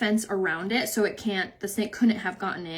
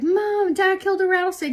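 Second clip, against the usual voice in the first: put-on higher, excited little boy voice; little son calling; 10 semitones higher, 4 dB louder than usual. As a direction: imitate an eager kid pointing out a snake. Son announcing snake news.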